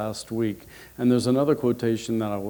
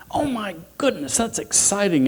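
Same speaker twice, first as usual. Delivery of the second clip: high-pitched excited squeal; squealing enthusiasm; 8 semitones higher than usual. Acted. Mother and sisters hearing of the engagement, squealing with enthusiasm. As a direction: gush in a high, thrilled voice. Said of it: "squealed with enthusiasm".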